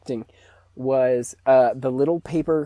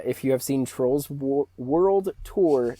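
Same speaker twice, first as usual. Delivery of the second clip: ordinary delivery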